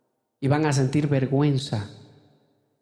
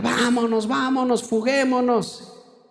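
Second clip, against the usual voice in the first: high, excited call to run off, the last word slow; egging the class on to leave before the teacher comes; mock schoolboy yelling; 7 semitones higher, slower than usual. Classmates shouting to skip out while the teacher is late, mock yelling.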